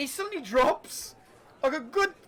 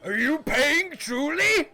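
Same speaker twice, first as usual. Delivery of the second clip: slow, higher innkeeper question; booming barkeep; 6 semitones higher, 5 dB louder, slower than usual. Acted. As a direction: lean in and ask slowly. Barkeep asks Mordai.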